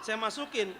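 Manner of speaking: ordinary talk, nothing marked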